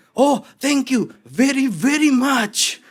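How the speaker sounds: loud, high, drawn-out thank you; mock excited gratitude; exclaiming thanks. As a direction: cry out thanks in delight. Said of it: "Dan saudara berseru".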